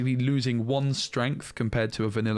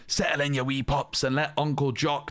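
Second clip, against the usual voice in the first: storyteller Uncle Jock in a put-on Scottish accent; mock Scottish accent. Reviewer voices Uncle Jock telling the kids a story, mock Scottish accent. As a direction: spin a yarn like a Scottish uncle, hammed-up Scottish lilt. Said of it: "I don't know what that accent was".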